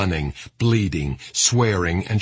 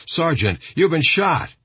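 higher-pitched narrated shout; shouted alarm; 9 semitones higher than usual. A narrator's reading. Soldier to his wounded sergeant while running beside him, shouted alarm. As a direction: call out sharply higher, a contained shout. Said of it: "shouted Specialist Colette".

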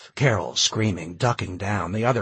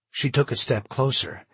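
plain reading voice

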